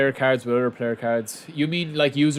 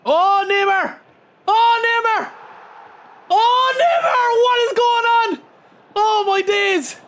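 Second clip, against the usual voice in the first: much higher and louder, yelling in disbelief at the goal; real euphoric roar, stretched slow on the repeats; ecstatic shouting; 5 dB louder, slower than usual. Real, not acted. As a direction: scream it out like the best goal you've ever seen. Stunned by a wonder goal.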